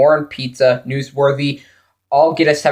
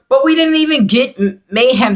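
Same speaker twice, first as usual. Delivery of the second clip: exasperated high outburst; 9 semitones higher than usual. Real, not acted. Disappointment about episode.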